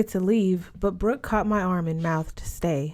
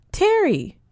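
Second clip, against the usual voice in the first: name snapped out high and loud as a rebuke; sharp warning; 12 semitones higher, 6 dB louder than usual. Acted. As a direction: cut her off with a warning. Brooke checks Terry's rude remark.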